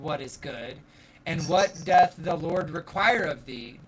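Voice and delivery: formal Bible reading voice; scripture reading. Acted. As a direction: read it like a verse from the pulpit. Reads scripture passage aloud.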